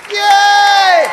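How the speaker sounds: cheer. Real, not acted. MC cheering the break.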